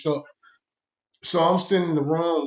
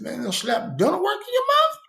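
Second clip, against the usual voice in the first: much higher, incredulous question; can't believe what he's hearing; shocked disbelief. Retold or imitated. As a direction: narrator reacting to the slapped cellmate, far higher in disbelief. Reacting to slapped out filling.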